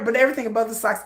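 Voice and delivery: normal talking voice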